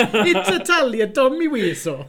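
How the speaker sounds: put-on slow, drawn-out Tommy Wiseau accent; mock Tommy Wiseau insisting it's Italian; Tommy Wiseau impression, each word stretched. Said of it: "the accent went like".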